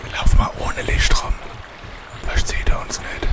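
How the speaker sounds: hushed whisper. Real, not acted. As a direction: whisper so nothing hears. Sneaking without light from monster.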